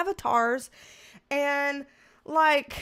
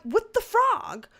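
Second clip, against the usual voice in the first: high, slow, drawn-out exasperated reply; sing-song it like you've given up arguing; weary high mock-exasperation; 9 semitones higher, 6 dB louder than usual. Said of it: "And I'm just exasperated".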